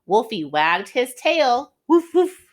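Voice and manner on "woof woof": high, loud barking woof woof; dog barks. Acted. Wolfie the dog barks at Miss W, high yapping. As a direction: bark like a happy little dog.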